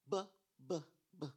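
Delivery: a short b b b, sound only; repeated b sound. Shown as a demonstration. Teacher isolating the b sound for the class. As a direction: tap out the b sound three times. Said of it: "They hear the same sound".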